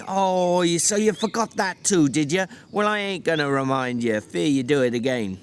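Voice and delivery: a weary ranch hand berating his companion; George, exasperated. Acted. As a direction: snap at him like a fed-up older brother. George warns Lenny.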